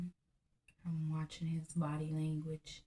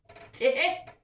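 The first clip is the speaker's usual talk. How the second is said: loud laugh; 7 semitones higher, 9 dB louder than usual. Real, not acted. Laughing at own rant.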